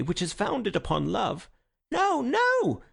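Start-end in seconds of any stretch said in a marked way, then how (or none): 1.9-2.8 Witherspoon's high heckling cry; heckler's protest. Acted. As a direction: shout it down from the floor. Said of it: "said Witherspoon".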